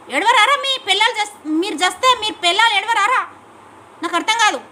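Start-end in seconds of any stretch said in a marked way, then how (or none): 0.1-3.3 loud, furious rhetorical demand; raised voice scolding opponents; angry shouting. Real, not acted. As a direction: hurl the question at them in anger. Angry rant at rival politicians.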